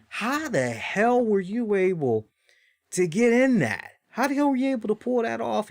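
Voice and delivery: high, disbelieving how-the-hell; incredulous. Retold or imitated. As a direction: retell the shocked question to a friend. Retelling asking friend incredulously.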